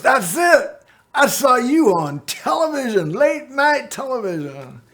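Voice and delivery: light up with sudden recognition; excited recognition. Retold or imitated. Taco Bell guy recognizing him.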